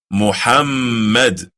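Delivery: Muhammad slow, the middle emphasised; correct pronunciation. Shown as a demonstration. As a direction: repeat Muhammad with clear emphasis. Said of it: "Do you hear the emphasis?".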